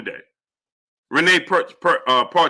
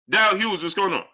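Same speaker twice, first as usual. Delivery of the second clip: ordinary delivery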